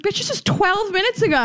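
high-pitched, incredulous comeback; hammed-up exasperation at a customer; mock indignant. Host retorting to a customer changing an order, mock indignant.

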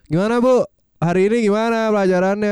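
cheeky student. Retold or imitated. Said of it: "Kan santai".

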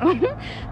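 breathless laugh. Real, not acted. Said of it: "I'm sorry, y'all".

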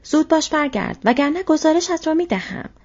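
loud, high shouted order; soldier's shout. A narrator's reading. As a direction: shout a threat after someone walking off. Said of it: "سرباز فریاد زد". (فریاد تهدیدآمیز سرباز با صدای بلند و زیر)

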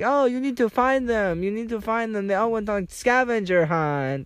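high mock voice, insistent; imitating cruise worker. Cruise worker urging him to find the group, high mock voice. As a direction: urge him on in a squeaky put-on voice. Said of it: "She's like".

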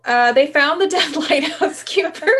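high, laughing through the words; can't stop giggling. Real, not acted. Restarting story while giggling.

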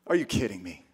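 low, soft acted disbelief; hushed, dismayed question; stunned disbelief. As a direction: drop the voice low, as if too stunned to shout. Acting Joseph hearing the pregnancy news.

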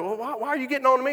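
high and fast, whiny protest; defensive husband. Retold or imitated. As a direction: protest like a man caught out. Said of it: "And he's like".

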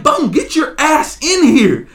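high-pitched irritated yell at a teammate; retold angry gamer outburst; pissed off. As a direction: bark it like a fed-up gamer urging a teammate on. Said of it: "I'm fucking getting pissed".

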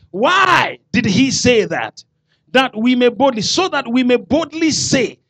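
full-force preaching. Acted. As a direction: proclaim the promise boldly.

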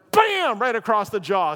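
a sudden shouted Bam, high and loud. Acted. Preacher telling a joke story shouts the punch, loud. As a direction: slam the word out like the punch landing.

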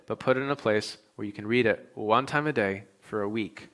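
ordinary talk

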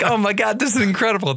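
high-pitched thrilled reaction, laughing; amazed delight. Retold or imitated. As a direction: marvel at it in a high, giggly voice. Acting out delighted reaction in theater.

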